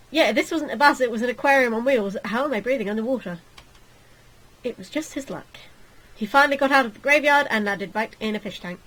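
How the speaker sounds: usual speaking voice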